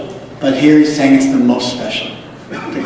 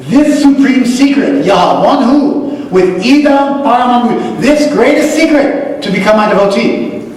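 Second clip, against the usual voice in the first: fervent preaching; 8 semitones higher than usual. Acted. Teacher preaching the Gita's secret to students, fervent. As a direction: preach it with rising conviction. Rising into fervent verse explanation.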